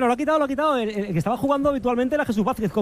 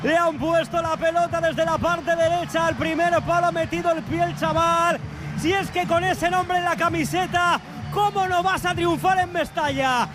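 breakneck goal commentary; 5 semitones higher than usual. Acted.

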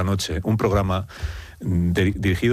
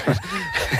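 carcajada. Real, not acted. Carcajada al oír "gastado.

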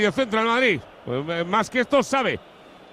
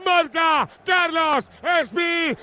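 slow, stretched shouted goal call; breakneck goal commentary; 7 semitones higher, much slower than usual. Real, not acted. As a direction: belt it out, stretched and high.

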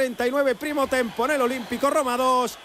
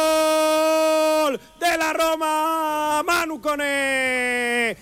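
drawn-out goal shout; 5 semitones higher, much slower than usual. Real, not acted. Goal call after Roma scores.

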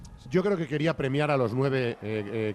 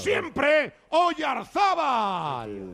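high, long-drawn shout of the player's name; slow triumphant cry, pitched high; full-voiced football commentary; 12 semitones higher, much slower than usual. Real, not acted. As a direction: stretch the name out like a radio commentator. Live play-by-play.

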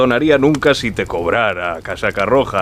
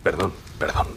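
sheepish quiet apology; softly, apologetic; 5 semitones lower, 9 dB quieter than usual. Acted. Innkeeper lowers voice.

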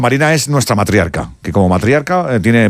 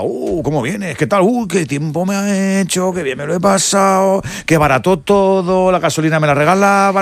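chinchar; 7 semitones higher than usual. Acted. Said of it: "decir ahora".

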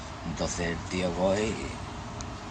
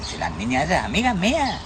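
high-pitched, lively joke voices; joke characters; 11 semitones higher than usual. Acted. Teller voicing two old friends meeting, high hammed-up voices. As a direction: pitch both men up and chatter.

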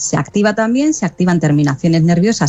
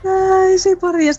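relieved sigh; 13 semitones higher, much slower than usual. Real, not acted. Relief at the idea of a massage.

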